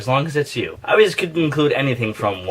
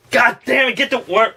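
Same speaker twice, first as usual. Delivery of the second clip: frustrated shout; 10 semitones higher than usual. Acted. Snapping in the skit to get the worm. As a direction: blurt it out in annoyance.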